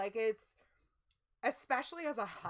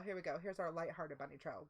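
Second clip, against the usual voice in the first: normal talking voice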